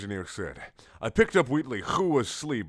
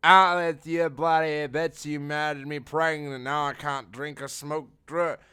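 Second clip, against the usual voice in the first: Peabody, mad; 7 semitones higher, 4 dB louder than usual. Acted. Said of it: "Peabody was so mad sounded".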